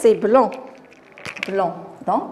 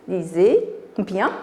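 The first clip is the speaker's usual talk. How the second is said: ordinary delivery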